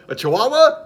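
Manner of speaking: high, loud disbelieving query; puzzled host, voice leaping up; incredulous question. Acted. Host doubts the guide dog.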